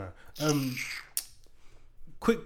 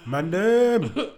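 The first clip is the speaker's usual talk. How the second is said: loud, high, stretched-out call; rallying shout; 6 semitones higher, 8 dB louder than usual. Acted. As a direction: summon the gang at full voice. Performs the advert's rallying call.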